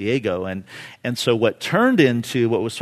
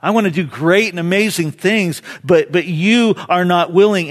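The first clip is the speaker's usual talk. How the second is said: loud, raised, pleading; impassioned cry; 6 semitones higher, 6 dB louder than usual. Acted. Jesus longing to work in your life, crying out. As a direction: press the offer with urgency. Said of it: "That is also his cry today".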